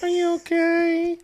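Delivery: high, slow fake concern; mock caring wife voice. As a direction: drawl it like a doting wife.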